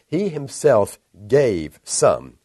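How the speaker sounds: HE HIMSELF stressed, slow and loud; slow, forceful stress on HE HIMSELF; emphatic. Shown as a demonstration. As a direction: spell out "He himself gave some" word by word with firm weight. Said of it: "It's very emphatic in the Greek".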